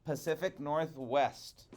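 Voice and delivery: plain reading voice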